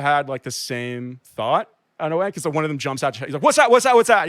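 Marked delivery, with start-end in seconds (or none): 3.4-4.3 imitated kid's loud, rapid-fire questioning; retold urgent demand. Kid challenging the man about his satchel, urgent retold demand. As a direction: fire it off higher and louder, as the kid did.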